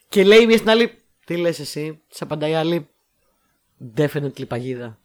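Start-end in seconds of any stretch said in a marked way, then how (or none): 1.3-2.0 low, soft deadpan question; totally impassive. Acted. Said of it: "γυρνάνε απαθέστατες η μία στην άλλη". 4.0-5.0 very low, soft deadpan; totally impassive. Acted. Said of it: "γυρνάνε απαθέστατες η μία στην άλλη".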